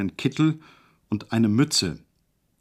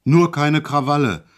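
louder, sudden bellow; 4 semitones higher, 6 dB louder than usual. A narrator's reading. Guard suddenly bellows at the patient, louder. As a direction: bark the warning at him. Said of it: "brüllte er auf einmal los".

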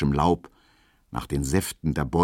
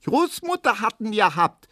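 very high little piping voice; high squeaky Berlin kid; piping child. A narrator's reading. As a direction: pipe up like a tattling child. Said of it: "piepst Erna".

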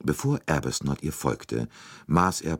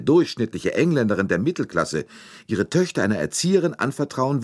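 higher, louder, heated challenge; indignant young governess; 8 semitones higher, 4 dB louder than usual. A narrator's reading. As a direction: argue back with rising indignation.

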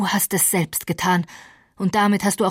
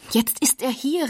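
exclaimed; 6 semitones higher than usual. A narrator's reading. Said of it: "rief ich aus".

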